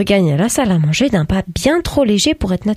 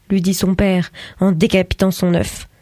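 plain reading voice

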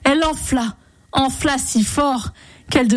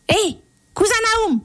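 very high, fast little-man call; 12 semitones higher, faster than usual. A narrator's reading. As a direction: squeak it out like a tiny bearded man. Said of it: "appela-t-il". (petit homme qui appelle d'une voix très aiguë)

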